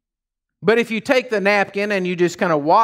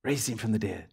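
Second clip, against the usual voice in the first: normal talking voice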